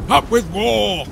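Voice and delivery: full-voiced rallying chant; shouting. A narrator's reading. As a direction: belt it out for battle. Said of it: "they shouted".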